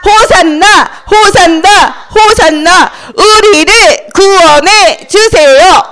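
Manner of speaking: slow, high, loud chant; syllable-by-syllable rallying cry; crowd shouting. Acted. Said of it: "이렇게 외쳤어요".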